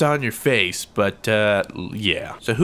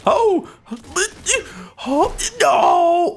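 panicked cry; much slower than usual. Real, not acted. Gamer caught in a sudden attack, panicked wail. Sudden threat mid-match.

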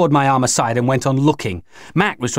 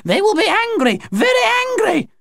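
very high, frantic alien voice; high, impassioned alarm; passionate warning. Acted. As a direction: warn in dread, pitched right up. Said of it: "Her voice was loud and passionate".

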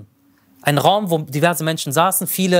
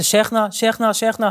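raised, higher calls trying to wake him; calling a name; 4 semitones higher than usual. Retold or imitated. A servant calls the sheikh lying still, raised and urgent. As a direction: call his name to rouse the sleeper. Man tries to wake the body.

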